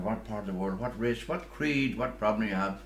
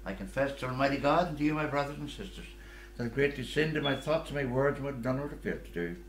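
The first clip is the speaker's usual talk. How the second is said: confession recited at Mass, slightly raised pitch; recited prayer; 4 semitones higher than usual. Acted. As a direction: recite a familiar congregational prayer.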